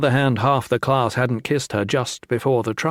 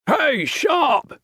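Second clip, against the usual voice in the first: bellowing. A narrator's reading. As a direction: call out at full lungs. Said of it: "one of the men bellowed".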